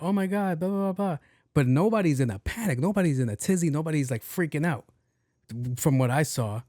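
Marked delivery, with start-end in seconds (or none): 0.0-1.2 high, mocking girl's voice; mocking woman. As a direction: parody her panic. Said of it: "She heard that".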